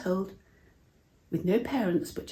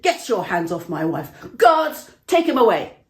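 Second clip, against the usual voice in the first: loud, furious order to the guards; furious command; 2 semitones higher, 9 dB louder than usual. Acted. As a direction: bellow for the guards to seize him. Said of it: "he was furious".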